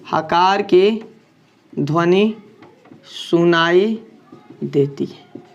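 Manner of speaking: हकार की ध्वनि नहीं सुनाई देती है dictated word by word with long pauses; slow dictation. Shown as a demonstration. Teacher dictating the definition for students to note, slowly. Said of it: "इसका definition हम लिख देते हैं".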